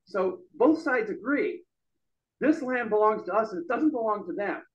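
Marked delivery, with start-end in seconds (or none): none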